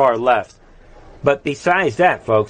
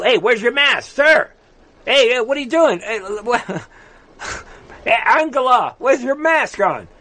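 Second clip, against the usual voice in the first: mock scolding; 10 semitones higher than usual. Host mock-scolding G20 leaders for no masks, high sing-song. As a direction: scold them in a much higher sing-song voice. Calling out maskless leaders mockingly.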